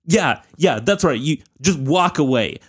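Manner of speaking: ordinary talk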